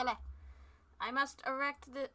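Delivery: ordinary talk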